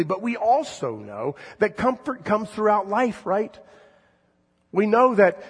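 full-force preaching. Acted. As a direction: drive it home to the congregation. Preacher building intensity.

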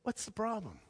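calm Jesus. Retold or imitated. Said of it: "and he says".

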